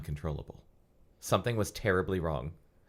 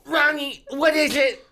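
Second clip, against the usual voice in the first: put-on very high, loud falsetto girl voice; Enid blurting; 9 dB louder, slower than usual. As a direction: blurt it out as a panicky teen girl. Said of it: "she blurted".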